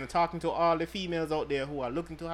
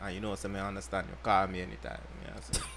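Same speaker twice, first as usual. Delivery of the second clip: mock Jamaican patois; 8 semitones lower, 8 dB quieter, faster than usual. Patois shout-out message.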